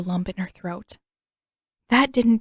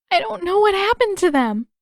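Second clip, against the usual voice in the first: high, louder and near breaking; tearful; 11 semitones higher, 5 dB louder than usual. A narrator's reading. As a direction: cry it out, lost. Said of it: "swiped the back of her hand".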